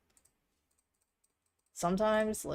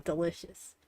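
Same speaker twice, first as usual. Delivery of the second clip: ordinary talk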